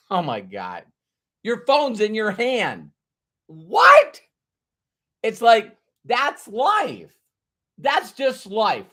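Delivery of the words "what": high, loud startled what; mock surprise. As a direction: shriek it in disbelief. Reacting to phone in hand.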